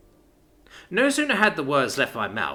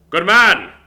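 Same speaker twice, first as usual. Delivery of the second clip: hearty praise; 6 semitones higher, 12 dB louder than usual. Acted. Father approves.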